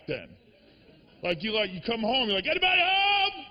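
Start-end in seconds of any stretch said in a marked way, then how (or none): 2.5-3.4 high sing-song call through the house; mock yell.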